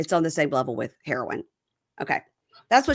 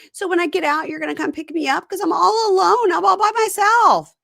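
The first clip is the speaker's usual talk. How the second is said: wife; 12 semitones higher, 5 dB louder than usual. Retold or imitated. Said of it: "And she goes".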